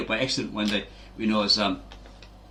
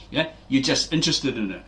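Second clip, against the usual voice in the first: ordinary talk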